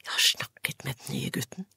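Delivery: soft secret whisper. A narrator's reading. Anne confiding in Beate about the new boy, whispered. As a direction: breathe the secret into her ear. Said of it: "hvisket det til henne".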